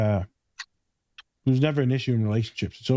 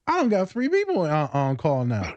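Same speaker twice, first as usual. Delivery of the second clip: much higher, incredulous protest; exasperated; 9 semitones higher than usual. Retold or imitated. Overloaded counselor complaining about extra patients, high exasperated voice. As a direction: throw up your hands in disbelief.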